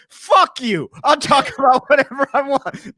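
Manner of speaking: angry. Retold or imitated. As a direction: bark it out in mock fury. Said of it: "Both of us just immediately anger".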